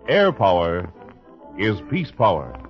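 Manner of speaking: ordinary delivery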